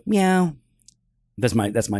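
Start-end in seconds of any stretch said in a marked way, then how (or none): 0.1-0.5 a lightly higher playful meow; mock cat meow. Joking about his app's alert setting, playful meow. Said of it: "mine goes".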